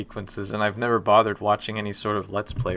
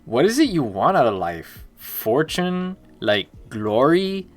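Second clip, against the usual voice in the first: put-on louder Miami bro accent; 4 semitones higher, 5 dB louder than usual. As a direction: pitch a trailer deal in a Miami accent. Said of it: "You want me to do the trailer speech for Rosewater in the Miami accent?".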